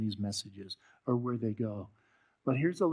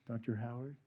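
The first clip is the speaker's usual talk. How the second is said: softer, hesitant calling of his name; soft earnest call; 7 dB quieter than usual. Retold or imitated. Young woman softly calling the preacher back, hesitant and earnest. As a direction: say his name gently, as if it matters. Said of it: "But when you hear".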